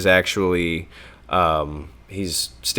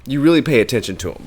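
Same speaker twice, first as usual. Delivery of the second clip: ordinary talk, nothing marked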